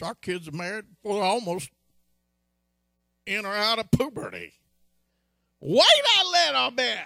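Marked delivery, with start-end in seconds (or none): 5.6-7.0 emphatic shout. Acted. Preacher urging the congregation to wait, shouting. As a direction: hammer the advice home.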